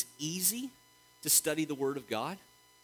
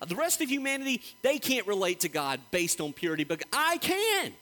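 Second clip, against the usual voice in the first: emphatic, higher-pitched declaration building to a loud "I can"; punchy, raised preacher's voice acting out a claim; voicing the perfect one; 6 semitones higher, 4 dB louder than usual. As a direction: proclaim it with rising force and total confidence. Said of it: "It is him showing".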